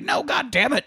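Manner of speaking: high-pitched cry of frustration; frustrated cry. Retold or imitated. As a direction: yelp it out, cheated. Tuco finding Carson dead.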